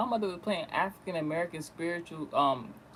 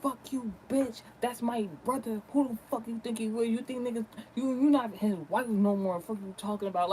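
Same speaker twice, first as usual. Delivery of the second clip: higher-pitched mock yell, volume held in; retold angry yelling; 5 semitones higher than usual. Daughter retelling mom cursing out the aunt, mock yelling. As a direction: imitate an angry telling-off without really shouting. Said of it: "she definitely was yelling at her".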